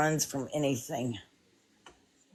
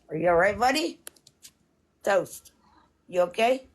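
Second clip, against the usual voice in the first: louder, rising check-in; calling to pet; 3 semitones higher, 6 dB louder than usual. Real, not acted. Owner checking on a pet, calling out. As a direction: call out to check on your pet.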